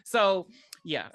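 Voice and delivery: normal talking voice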